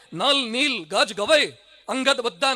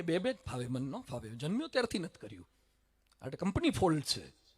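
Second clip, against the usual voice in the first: low, soft, sheepish excuse; timid lamb; 7 semitones lower, 11 dB quieter than usual. Acted. The lamb makes excuses to the lion, soft and sheepish. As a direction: mumble it like a scared lamb.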